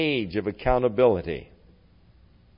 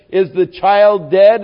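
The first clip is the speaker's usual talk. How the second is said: higher, louder, slow retold question; acting out a pressing, raised-voice question; urgent questioning; 7 semitones higher, 10 dB louder than usual. David asks his whispering servants, urgent and loud.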